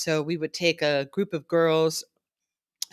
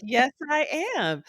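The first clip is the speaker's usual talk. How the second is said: higher, proud answer; proud executive; 10 semitones higher than usual. Retold or imitated. YouTube vice president answering the girls, high and proud. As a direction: answer the kids with pride. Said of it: "she's like".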